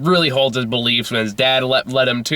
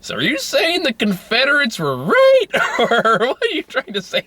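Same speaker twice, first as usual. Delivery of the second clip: much higher, mock disbelief; incredulous challenge; 12 semitones higher than usual. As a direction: ask it as if you can't believe it. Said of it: "I'm like".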